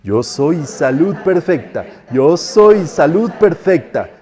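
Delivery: slow, drawn-out chant, swelling in the middle; practice decree, building. Shown as a demonstration. Teacher leading students in practice, slow rising decree. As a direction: lead the group, raising it gradually. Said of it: "lo vamos subiendo para que practiquen".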